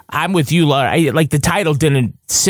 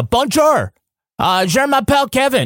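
much higher, hammed-up Québécois French; mock French Canadian accent; 11 semitones higher than usual. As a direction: play up the Canadian twang. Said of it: "but in Canada, it's like".

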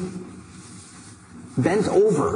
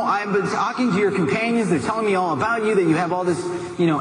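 ordinary delivery

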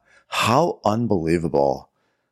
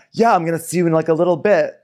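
high, light mock-casual voice; a hint of a flighty girl; breezy promise; 10 semitones higher, faster than usual. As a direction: toss it off airily. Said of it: "when she said".

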